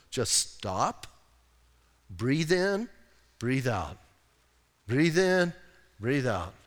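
soft breathing coach, falling low on each out; calming. Shown as a demonstration. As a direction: lead slow breaths with pauses between.

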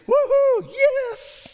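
high whooping shout of joy; loud high joyful whoop; excited cheer. Retold or imitated. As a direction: cheer crossing the sea. Israelites cheering after crossing.

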